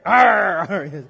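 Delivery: a loud pirate "arr", then a lighter one; playful pirate arr with a loud first one. Pretend. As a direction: growl it like a pirate. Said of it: "I sound like a pirate".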